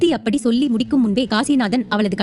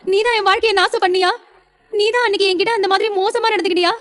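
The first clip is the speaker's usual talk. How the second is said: high-pitched, anguished accusation; tearful outrage; 7 semitones higher than usual. Acted. Betrayed wife confronting the husband who deceived her, tearful outrage. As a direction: confront him through tears, voice pitched up. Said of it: "இப்படி அழாத".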